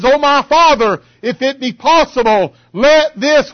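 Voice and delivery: high, loud preacher's plea; crying out in prayer. Retold or imitated. Preacher voicing Jesus pleading with the Father, crying out. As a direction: cry it out to heaven, imitating prayer. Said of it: "He's crying unto the Father".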